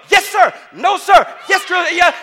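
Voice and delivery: high, loud shouted recruit replies; recruit yes sir. Retold or imitated. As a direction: snap back like a boot camp recruit. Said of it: "And Hannah was like".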